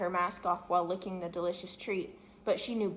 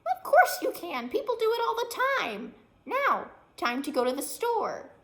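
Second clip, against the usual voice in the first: very high, loud insistence; a pushy little critter taking charge; bossy chipmunk; 14 semitones higher, 6 dB louder than usual. Acted. Chipmunk insists the girl take it home, squeaky.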